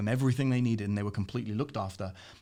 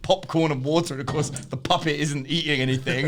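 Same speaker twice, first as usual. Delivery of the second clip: normal talking voice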